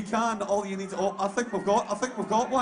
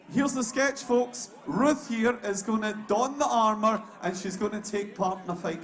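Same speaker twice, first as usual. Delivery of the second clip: usual speaking voice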